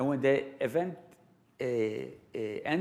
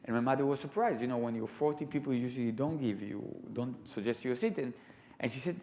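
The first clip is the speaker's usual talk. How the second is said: ordinary talk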